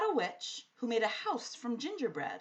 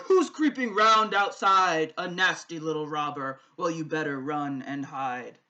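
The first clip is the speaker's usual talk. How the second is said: roaring giant; 5 semitones lower, 10 dB louder than usual. A narrator's reading. The giant roars at an intruder outside his castle. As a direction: growl out a threat like a giant. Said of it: "Suddenly the giant roared".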